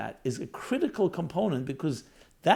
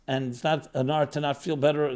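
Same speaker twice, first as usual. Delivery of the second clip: ordinary delivery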